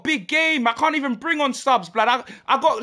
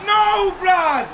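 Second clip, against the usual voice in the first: loud, raised cries of protest; outraged shouting; 5 semitones higher, 3 dB louder, much slower than usual. Real, not acted. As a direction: shout it out in disbelief. Outburst after a goal in game.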